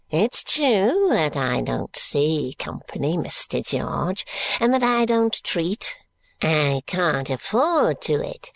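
put-on old Smallweed. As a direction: drag it out like a stingy old man. Smallweed voice, slow high.

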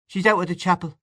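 put-on high child's voice; little boy. A narrator's reading. Little boy says where mother is, high child voice. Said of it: "his son's flat accent".

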